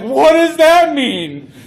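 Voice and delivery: loud, high, slow bewildered question; mock confusion. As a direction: ask it totally lost. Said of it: "And you're like".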